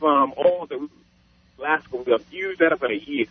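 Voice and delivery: usual speaking voice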